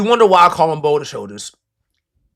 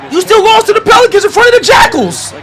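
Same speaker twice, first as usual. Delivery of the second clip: exasperated yell; 12 semitones higher, 4 dB louder than usual. Real, not acted. Frustrated rant at the team.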